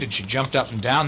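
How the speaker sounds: ordinary delivery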